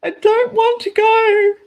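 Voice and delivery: high-pitched pleading whine to parents; retold begging. Child begging parents not to send them to school, pleading. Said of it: "I just begged my parents".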